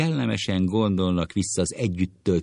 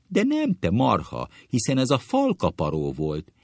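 indignant correction; 7 semitones higher, 4 dB louder than usual. Acted. Friend indignantly corrects the impression.

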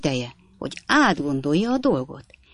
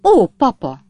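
reproachful; 5 semitones higher, 8 dB louder than usual. Acted. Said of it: "szólt Napkins kisasszony szemrehányóan".

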